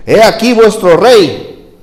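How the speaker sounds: high, loud, slow proclamation; Pilate proclaiming. Acted. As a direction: present the king to the crowd. Pilate presenting Jesus. (proclamación lenta y aguda)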